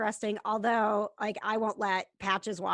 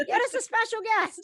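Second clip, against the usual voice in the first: excited repeat; 11 semitones higher, 4 dB louder than usual. Acted. Repeats cohost line much higher.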